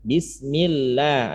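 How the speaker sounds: "Bismillah" with the lam held, slowly; held double l. Shown as a demonstration. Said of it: "Ada tahanannya". (lam ditahan pelan)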